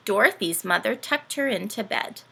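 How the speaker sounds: ordinary delivery, nothing marked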